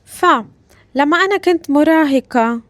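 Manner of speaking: usual speaking voice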